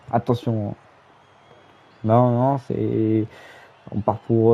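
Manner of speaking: live play-by-play call; wrestling commentator. Acted. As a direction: narrate the match as it happens.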